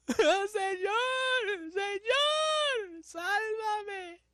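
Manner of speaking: very high, drawn-out drowning cry; dramatized shouting plea, near crying; desperate cry for help. Acted. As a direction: wail out in acted panic. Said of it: "dio voces diciendo".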